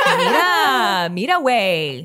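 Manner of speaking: South Texas Spanish street call; loud, drawn-out South Texas Spanish, half laughing; South Texas accent. Shown as a demonstration. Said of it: "That's what they say".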